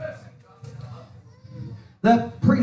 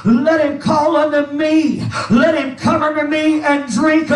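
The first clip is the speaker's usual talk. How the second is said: driving, shouted pulpit cadence with gasped "uh"; full-force preaching; 7 semitones higher, 4 dB louder than usual. Acted. Preacher explaining the feast to the congregation, full-force preaching. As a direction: preach in punched phrases, building in intensity.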